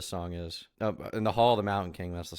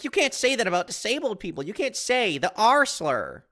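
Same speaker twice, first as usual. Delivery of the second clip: much higher, whiny mock outrage, slowing at the end; mocking whiny quote; 11 semitones higher than usual. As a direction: squeak it out like a scandalised prude. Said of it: "being like".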